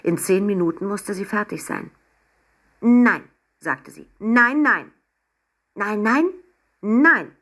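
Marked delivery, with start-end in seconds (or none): 2.8-3.2 loud, higher sharp Nein; firm no. Acted. Emma forbids the penguin the bathtub, loud firm no. 4.2-7.4 higher, loud string of nein; repeated no. Acted. Emma keeps scolding the penguin, loud insistent no.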